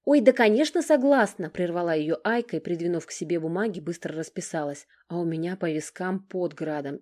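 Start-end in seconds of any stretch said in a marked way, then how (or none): 0.1-1.5 high, loud, eager yes; overjoyed bride jumping in, high and loud; eager interruption. A narrator's reading. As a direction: blurt it out, cutting her off.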